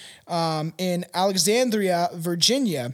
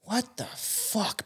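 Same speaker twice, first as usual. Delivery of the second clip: stunned mutter; 3 semitones higher, 9 dB quieter, much slower than usual. Retold or imitated. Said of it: "This police officer's like".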